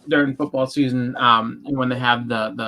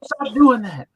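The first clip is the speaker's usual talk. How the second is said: higher and louder, hammed-up scolding order; mock scolding friend; 11 semitones higher, 9 dB louder than usual. Friend ordering him to stop eating candy, mock scolding. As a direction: boss them off the candy, high and loud. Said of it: "He's like".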